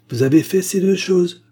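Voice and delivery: loud, high-pitched charge; forceful accusation. Acted. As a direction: override the protests loudly. Agar overrides his protests, accusing.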